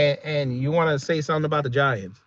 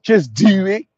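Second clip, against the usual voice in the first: repeated answer in exaggerated Baltimore accent; Baltimore-accent 'do', drawn and rising; mock Baltimore accent; 2 semitones higher, 4 dB louder, slower than usual. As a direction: lean on the Baltimore fronted 'do'. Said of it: "but you can't say "do" correctly".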